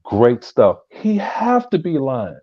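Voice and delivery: mock outrage. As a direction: call out the liar, voice climbing. Mock outrage at a viewer's lie.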